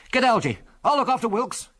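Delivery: high-pitched shouted orders; urgent yell. A narrator's reading. Biggles racing to the crashed machine, urgent yelled orders. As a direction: shout instructions in an emergency. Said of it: "yelled Biggles".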